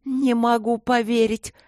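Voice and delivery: high, slow outraged disbelief; indignant. A narrator's reading. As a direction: draw it out in shocked indignation. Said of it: "возмутилась Горни".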